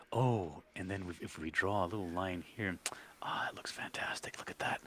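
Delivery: whispering like an ASMR artist; breathy whispered art tutorial; mock ASMR whisper. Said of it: "be like".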